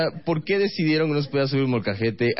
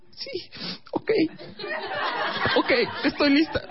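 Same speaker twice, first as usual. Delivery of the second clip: put-on very high, tearful girlfriend voice; teary girlfriend. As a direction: sniffle it out like a crying girlfriend.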